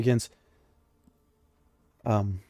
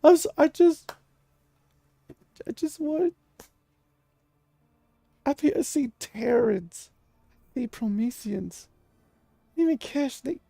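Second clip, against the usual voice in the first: desperate shout fading; 14 semitones higher, 5 dB louder than usual. Acted. Spirit begs Silva not to leave, shout fading to plea. Possessed character pleading, fading.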